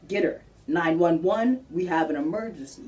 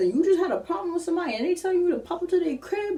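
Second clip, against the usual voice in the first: heated rant; 13 semitones higher than usual. Real, not acted. Ranting about a setup.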